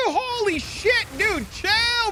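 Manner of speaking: high, slow squealing holy shit; excited squeal. Real, not acted. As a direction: shriek with delight. Reacting to gifted subs.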